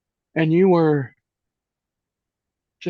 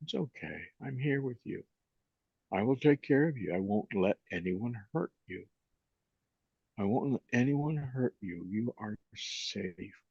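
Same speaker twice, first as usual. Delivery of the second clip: put-on comforting inner child; 10 dB quieter than usual. Shown as a demonstration. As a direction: speak to a scared four-year-old, softly. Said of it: "And I just say".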